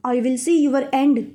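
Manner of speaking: plain reading voice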